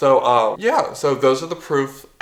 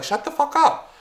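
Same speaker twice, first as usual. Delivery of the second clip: exasperated outburst; 13 semitones higher than usual. Real, not acted. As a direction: shut the fuck up shot way up in pitch. Angry ranting at influencers.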